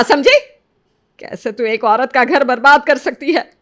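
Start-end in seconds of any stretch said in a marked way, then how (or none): 0.0-0.4 समझी? shouted high and loud with a sharp rise; shouted warning. Acted. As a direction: bark समझी? as a threat. (समझी? ज़ोर से चिल्लाकर)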